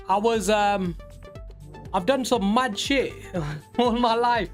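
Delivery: ordinary delivery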